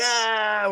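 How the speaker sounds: very high, held "ah"; mock yelp. Friends react to the prank, high mock squeal. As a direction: imitate friends squealing in disbelief.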